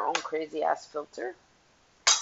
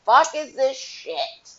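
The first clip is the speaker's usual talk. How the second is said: shocked outburst; 6 semitones higher, 8 dB louder, much slower than usual. Retold or imitated. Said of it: "you're like".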